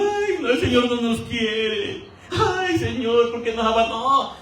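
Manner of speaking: slow, whiny mock crying; hammed-up wail of abandonment; Paul and Silas weeping in jail, mock crying; mock weeping lament. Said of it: "los dos están llorando".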